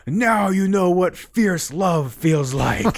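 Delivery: high, slow, otherworldly voice from infinity; booming cosmic voice. Retold or imitated. A voice from infinity speaks to him, slow and high. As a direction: intone it slowly like a voice from beyond.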